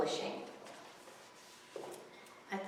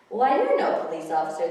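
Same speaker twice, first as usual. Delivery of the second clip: put-on kid scoffing; 9 dB louder, faster than usual. Kid sees through disguise.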